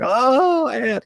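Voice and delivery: high, drawn-out mock wail; mock blubbering. Buddy breaking down at a coworker's funeral, mock blubbering. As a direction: imitate a big man blubbering. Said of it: "just starts blubbering".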